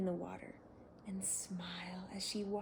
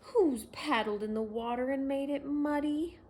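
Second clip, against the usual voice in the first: snorted; 5 semitones higher, 8 dB louder than usual. A narrator's reading. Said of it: "he snorted".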